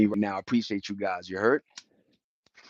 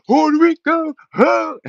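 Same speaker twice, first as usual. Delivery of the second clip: very high, loud excited yell; real excited cheer; hyped shout; 7 dB louder, slower than usual. Real, not acted. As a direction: belt the island's name at the peak. Hyping his home island.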